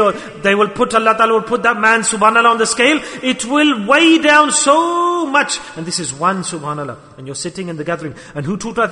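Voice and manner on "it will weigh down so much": drawn out. Acted. Preacher stretching the weight image.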